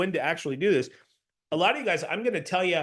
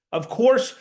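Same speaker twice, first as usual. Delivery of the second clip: confident of course; 7 semitones higher, 5 dB louder than usual. Shown as a demonstration. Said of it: "with an assertive, confident tone".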